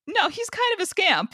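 mock-indignant high protest; playful correction. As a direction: pitch it way up to correct them playfully. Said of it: "I'm like".

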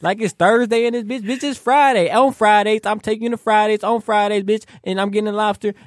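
half-acted high-pitched telling-off at his date; cussing her out. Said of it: "I cussed her out".

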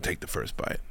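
very low, soft command; quiet, intense urging; hushed insistence. Retold or imitated. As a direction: say it under your breath as an order. Urging wife to taste.

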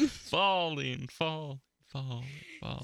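high, fading echo; cave echo. Pretend. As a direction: let it fade like an echo.

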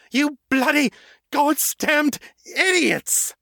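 very high, slow furious scream; vampire screaming at the men who set off the blast; furious shout. Acted. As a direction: let rip at the fools. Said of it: "Morgan shouted".

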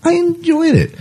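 high fan squeal of delight; gushing. Real, not acted. Enjoyed the premiere.

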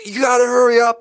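frantic yell. Retold or imitated. Waiter yelling at the chef to hurry, high and loud. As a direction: hurry them up in a panic. Panicked kitchen, everyone screaming.

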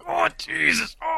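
high strained cry of pain. Acted. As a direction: cry out as the arm twists. Pinned man in pain.

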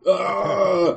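high, drawn-out groan of indecision; exasperated high "aaah"; agonized groan. Real, not acted. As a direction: groan long and high over a hard choice. Agonizing over a guess.

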